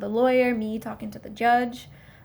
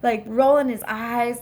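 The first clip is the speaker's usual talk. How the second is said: normal talking voice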